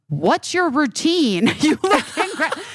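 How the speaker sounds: high-pitched mock shout, drawn out; imitated shouting passerby. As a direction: play an amazed stranger calling out, breaking into laughter. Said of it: "people will shout at me".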